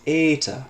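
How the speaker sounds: emphatic teacher demo of eta; long e demo. Shown as a demonstration. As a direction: make eta ring out as "ay-ta". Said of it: "the other is long".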